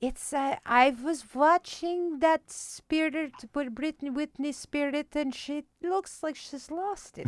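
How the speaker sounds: gentle worried mom gossip; imitating sweet mom on a pop star; soft-spoken sweet mom. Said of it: "soft-spoken and sweet".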